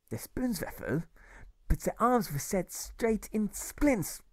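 a kindly German spinning a parable; German storyteller. Acted. Grocer tells Alfie the two dining rooms story in a German accent.

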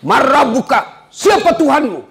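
high, stern angel interrogating; questioning angel. Acted. Angel in the grave questions the dead man, high and stern. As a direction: demand it from above, high and firm.

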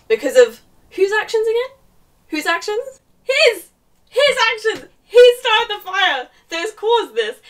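high, loud indignation; real exasperated yelling, pitched high; outraged rant. Real, not acted. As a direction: shout the blame in outrage.

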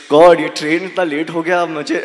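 fast nervous excuse; rushed excuse. Retold or imitated. Commuter gabbling a late-train excuse to the checker, fast. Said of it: "I'm like".

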